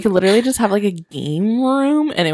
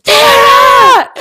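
high loud shout of a name; mock calling out; 11 dB louder than usual. Pretending to call her sister across the dance floor, shouting.

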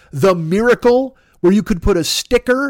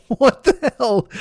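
incredulous; 7 semitones higher, slower than usual. Real, not acted. Outraged at Trump hotel scheme.

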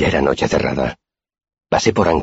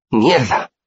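high-pitched exclaimed curse; dismayed curse; 9 semitones higher than usual. A narrator's reading. Simón spills his drink over the table, high dismayed curse.

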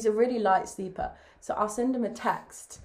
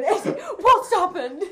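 panicked mum woken suddenly; startled mum; 9 semitones higher, 6 dB louder, slower than usual. Retold or imitated. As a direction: jolt awake, alarmed.

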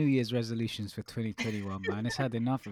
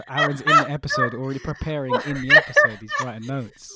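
loud, laughing exclamation; helpless amused disbelief, much louder; incredulous laughter; 4 semitones higher, 12 dB louder than usual. Real, not acted. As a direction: call it out while cracking up. Cracking up at friend's note-taking.

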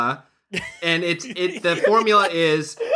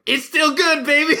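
excited quote; 11 semitones higher, 3 dB louder than usual. Retold or imitated. Retold reaction in movie.

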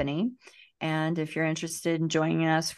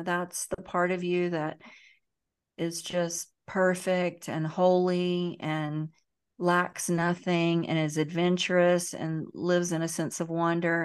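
normal talking voice

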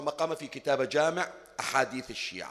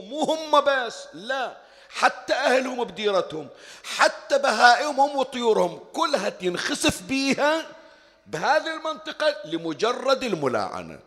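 preaching; 7 semitones higher, 4 dB louder than usual. Acted. Escalating description of destruction.

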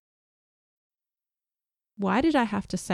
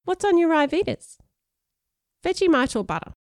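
put-on higher-pitched boy's question, rising and falling sharply; Sean's eager question; 10 semitones higher, 3 dB louder than usual. Sean eyeing a friend's lunch, curious higher-pitched boy. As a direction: ask it up high, a curious schoolkid.

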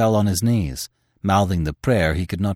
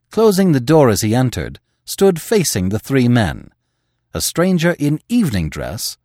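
usual speaking voice

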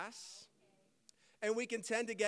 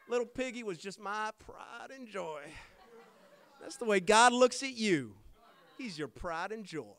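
mock southern accent; 4 dB louder than usual. Preacher mocking Joel Osteen for his congregation, southern accent. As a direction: parody a soothing southern televangelist accent. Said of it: "it's in this little southern accent".

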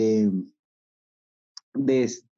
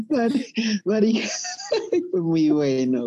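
laughing; 9 semitones higher than usual. Real, not acted. Cracking up at gross scene.

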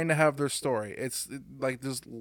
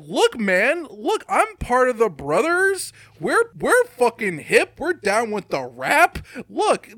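high, loud, drawn-out fake-hip pitch; loud mocking sell, rising to a squeal; mock pandering; 10 semitones higher, 9 dB louder, slower than usual. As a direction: ham it up, growing higher and more desperate to be noticed. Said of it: "where it's just like".

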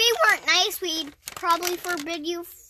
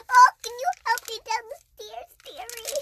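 put-on high, frail old lady asking for help; quavery grandma pleading; 6 semitones higher than usual. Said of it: "this is the best old person voice".

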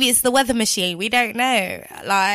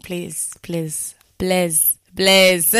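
mock African accent crooned over the track; bad African accent; 3 semitones higher, slower than usual. Radio host jokingly sings along to listeners in a bad African accent. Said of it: "That was a really bad African accent".

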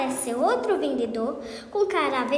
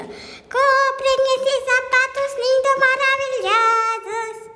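much higher, louder, slow sing-song hawking; very high shouted voice; 11 semitones higher, 5 dB louder, slower than usual. Acted. Patão calls out to passers-by selling shoes, high sing-song shout. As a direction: sing out the sales pitch in a shrill high voice. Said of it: "gritando com uma voz muito aguda".

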